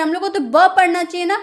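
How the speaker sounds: ordinary talk, nothing marked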